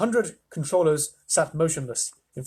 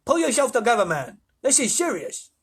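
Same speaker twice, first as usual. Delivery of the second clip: high-pitched, exasperated controller; testy official rebuke; stern scolding; 9 semitones higher, 4 dB louder than usual. A narrator's reading. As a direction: tell him off sharply. Ground control scolds astronaut.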